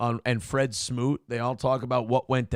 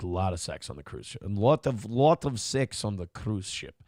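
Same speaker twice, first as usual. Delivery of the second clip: put-on Russian accent; 7 semitones lower, 5 dB quieter than usual. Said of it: "She goes".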